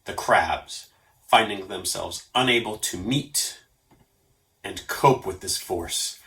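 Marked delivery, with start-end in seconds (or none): none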